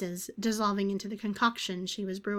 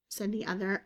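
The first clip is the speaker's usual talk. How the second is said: ordinary delivery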